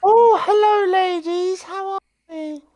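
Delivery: very high falsetto, hammed-up charmer; mock flirty greeting.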